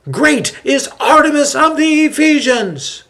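very high, loud chant at its peak; rising crowd shout. A narrator's reading. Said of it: "the men cry out".

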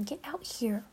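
unvoiced pleading whisper; whispered come-on. Real, not acted. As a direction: breathe the plea quietly. Whispered plea at the end.

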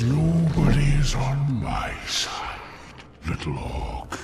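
Treebeard voice on the inhale; slow, breathy speaking on the inhale; talking while inhaling. Shown as a demonstration. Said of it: "trying to create the sense of speaking on the inhale".

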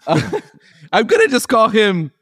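high laughing aside; giggling at the mixup. Real, not acted.

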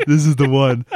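slow, giggly goofball gloat; dopey gloating voice. Retold or imitated. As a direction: grin through it like a goof.

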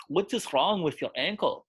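a Swiss German doctor asking slowly. Retold or imitated. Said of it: "he's a Swiss German".

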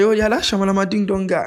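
high, playful voice of a passer-by; imitating fans in the street. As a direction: lightly mimic a fan spotting him. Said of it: "people are on the street".

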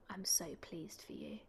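secret whisper. Retold or imitated. Resident whispers secret congratulations.

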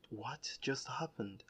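soft, slow, dazed question; bewildered hush; stunned disbelief. Retold or imitated. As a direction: let the shock drain the voice. Stunned by calm reaction.

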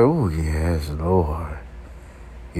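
low, soft churchy moan of praise; a drawn-out Madea-style sigh. Retold or imitated. Mock Madea moan while imagining sex. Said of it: "Like Madea around this motherfucker".

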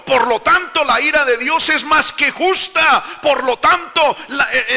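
builds from a firm voice to a high shout; the king shouting his guilt, rising into a cry; anguished outcry. Acted. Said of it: "Inmediatamente se comparó él".

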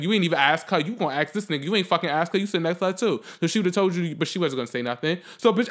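ordinary delivery

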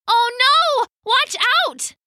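high, loud warning shout; alarmed cry. Acted. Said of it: "Kaya cried".

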